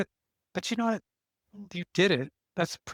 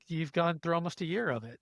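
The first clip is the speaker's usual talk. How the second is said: ordinary talk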